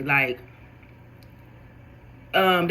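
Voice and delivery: ordinary delivery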